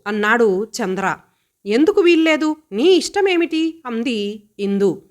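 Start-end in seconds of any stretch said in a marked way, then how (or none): 1.7-3.8 higher, indignant protest; defiant retort. Acted. Sister defying brother's order, indignant and high. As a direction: throw the question back at him.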